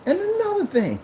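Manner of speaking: high-pitched mock indignation; cursing someone out. Projector cursing out its owner, hammed-up outrage. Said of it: "as I'm cursing you out".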